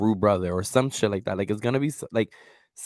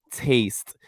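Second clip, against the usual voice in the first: usual speaking voice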